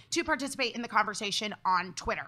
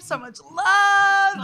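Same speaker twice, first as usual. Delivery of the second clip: excited; 6 semitones higher, 6 dB louder, much slower than usual. Acted. Hyping up the show intro.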